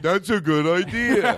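drawn out and slow, like a monster; slow mock-monster agreement; slow monster take. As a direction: stretch it slowly like a grumpy monster. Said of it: "like a monster, I'm like".

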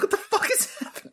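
high-pitched incredulous squeal; mock bewilderment. Viewer reacts to a talking dog, high mock bewilderment. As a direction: squeak it out in baffled disbelief.

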